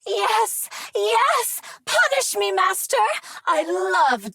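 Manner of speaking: hammed-up pleading ecstasy; ecstatic cries. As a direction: cry out in mock rapture.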